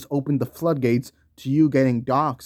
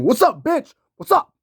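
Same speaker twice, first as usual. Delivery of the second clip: much higher and louder mock confrontation; threatening yell; 13 semitones higher, 6 dB louder than usual. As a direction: bark it like a star at your door. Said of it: "LeBron going up to your house and saying".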